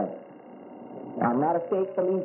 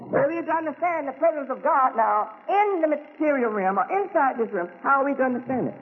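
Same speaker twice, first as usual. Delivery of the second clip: higher, raised preaching voice; 8 semitones higher than usual. Acted. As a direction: lift it up and preach to the congregation. Preaching question to congregation.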